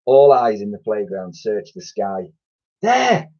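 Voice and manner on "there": high excited call; spotting cry; shout. Acted. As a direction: point at the sky and call out. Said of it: "shouted Ned".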